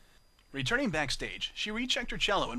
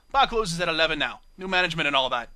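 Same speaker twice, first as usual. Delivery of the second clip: loud, rough British barmaid voice; blunt British bar mare refusal; rough British accent; 8 dB louder than usual. Acted. Bar mare refusing Octavia, rough British accent. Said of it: "rough, British accent".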